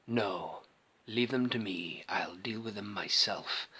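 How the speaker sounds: low, slow, softly menacing villain impression; Vader. Quoting the film scene to viewers, quiet low villain voice. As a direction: drop low and quiet, measured, like a dark lord. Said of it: "I can see that scene where he says".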